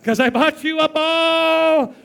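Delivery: high, loud, slow triumphant cry; triumphant shout. Retold or imitated. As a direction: crow like a proud Santa. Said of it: "And I'd be like".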